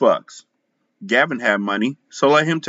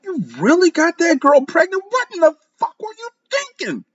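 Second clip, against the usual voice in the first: high, outraged questioning; wife confronts husband, pitched up in outrage; furious disbelief. A narrator's reading. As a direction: demand it high and incredulous.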